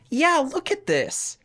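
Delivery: high-pitched mock complaint; high mock whine. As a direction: voice jumps way up, playful. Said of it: "I go".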